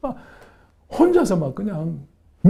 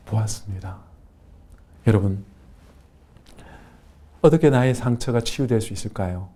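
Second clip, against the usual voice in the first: ordinary talk, nothing marked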